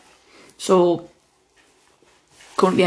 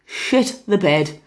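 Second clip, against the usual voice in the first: slow, drawn-out "Shit the bed"; slow panicked repeat; much slower than usual. Retold or imitated. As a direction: stretch out "Shit the bed" in dread. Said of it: "we were just like".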